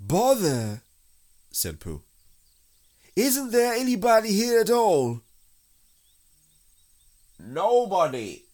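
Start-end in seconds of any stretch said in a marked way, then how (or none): 0.1-0.8 high, loud little grumble; bothered bear. A narrator's reading. Pooh is thwarted. 3.2-5.2 high, loud bear plea; insistent storybook bear; calling out. A narrator's reading. Pooh calls into the hole. 7.4-8.4 high, slow singing-out answer; high little creature voice; evasive rabbit. A narrator's reading.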